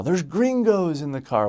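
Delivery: high-pitched mock yell at normal volume; retold yell. Rioter spotting foreigners in the car, high mock yell. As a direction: imitate someone calling out from afar. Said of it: "I hear one of them yell".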